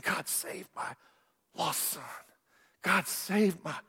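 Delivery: soft, halting plea to God; retold altar prayer, barely voiced; hushed crying out. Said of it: "and they're crying out".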